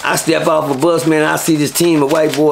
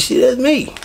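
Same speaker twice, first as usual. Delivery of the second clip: startled; 8 semitones higher than usual. Retold or imitated. His own startled answer.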